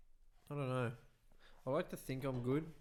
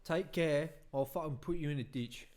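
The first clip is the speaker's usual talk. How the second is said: put-on a light, louder English accent try; 3 semitones higher, 5 dB louder than usual. Asked to do English, a louder English accent attempt. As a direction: bark it out in a London accent. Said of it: "What type of English?".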